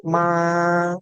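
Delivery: "Ma" held high and flat; teacher shows the straight tone: "Ma" high and level; level first tone. Shown as a demonstration. "Ma" steady like a straight line. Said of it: "oh, nada lurus".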